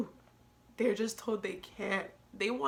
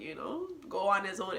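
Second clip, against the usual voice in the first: ordinary delivery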